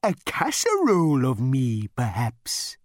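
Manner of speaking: high, slow, courteous cow; polite cow voice. A narrator's reading. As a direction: offer yourself like a cow waiter. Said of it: "it added".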